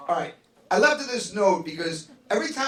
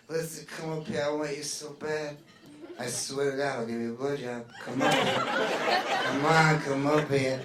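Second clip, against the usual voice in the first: all sexy; 8 semitones lower, 6 dB quieter than usual. Retold or imitated. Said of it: "she's like".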